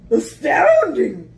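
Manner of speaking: loud, stretched-out monster voice; astonished Gruffalo. Acted. Gruffalo marvelling at mouse's power, loud and slow. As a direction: boom it slowly in wonder.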